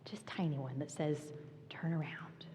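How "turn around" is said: soft, hushed voice; little whisper. Retold or imitated. God's tiny whisper telling her to turn around. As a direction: barely breathe it out. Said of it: "And I hear this little whisper".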